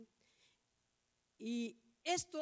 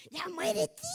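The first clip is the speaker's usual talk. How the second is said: louder, higher mock yell at a pet; exasperated shout; 5 semitones higher, 6 dB louder, slower than usual. Preacher re-enacting yelling at his old dog, exasperated shout.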